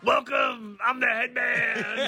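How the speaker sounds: high, slow, loud goofy intro; goofy announcer voice. Pretend. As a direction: ham it up as a silly mascot. Hamming a headband character.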